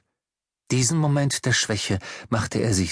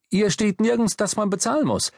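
much higher, insistent objection; indignant, raised-pitch argument; agitated protest; 9 semitones higher than usual. Acted. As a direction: protest your innocence, voice pitched up. Protesting to the traffic warden.

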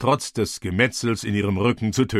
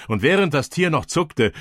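plain reading voice

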